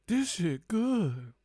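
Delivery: high, slow exaggerated savoring; drawn-out relish over a drink. Real, not acted.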